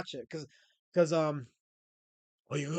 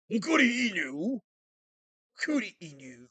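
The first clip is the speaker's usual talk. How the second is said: mock Japanese rough voice; 4 semitones higher, 5 dB louder than usual. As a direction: shout "Okuri Inu" like a mock samurai. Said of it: "with the Japanese rough voice?".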